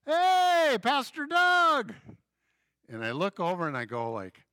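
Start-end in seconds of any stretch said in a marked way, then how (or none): 0.0-1.9 high, loud shout across the crowd; calling out. Retold or imitated. Fan shouting to his pastor at a packed game, loud. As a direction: hail him from far off in the stands. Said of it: "this guy goes".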